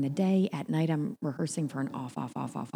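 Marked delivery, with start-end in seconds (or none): none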